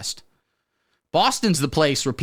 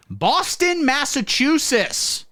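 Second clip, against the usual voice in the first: announcer; 12 semitones higher, much slower than usual. Acted. Game-show announcer city intro.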